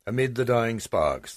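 ordinary delivery, nothing marked

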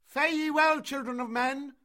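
high, loud, contemptuous tirade; scornful shout. A narrator's reading. Mowgli leaving the children of men, scornful shout. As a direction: shout your scorn at the whole village. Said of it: "shouted Mowgli scornfully".

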